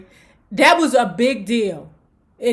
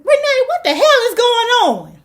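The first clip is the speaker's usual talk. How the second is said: higher mock alarmed grandma; a startled grandmother shouting down the hall; grandma calling out; 11 semitones higher, 4 dB louder than usual. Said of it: "Grandma got up and said".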